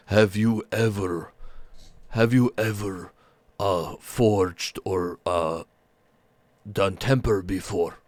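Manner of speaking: slow, welcoming big ogre voice; gentle ogre smith. Acted. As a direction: greet them warmly, slowly, like a giant.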